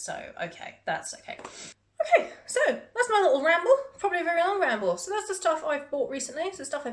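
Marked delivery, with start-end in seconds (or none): 1.4-6.6 bright sign-off. Real, not acted. Wrapping up the ramble brightly.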